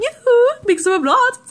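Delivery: high, loud singsong catchphrase; mimicking the alarm. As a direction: chirp it like a relentless alarm. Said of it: "my alarm went off and kept going".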